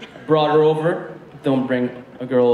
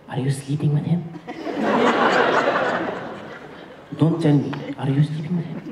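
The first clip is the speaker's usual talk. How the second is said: put-on close, hushed accented mom question. Mom whispering close to girlfriend.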